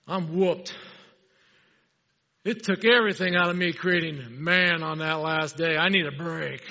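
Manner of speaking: a mock-weary booming God voice; tired God voice. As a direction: play God wiped out after creating. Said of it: "I gotta say it in a deep voice".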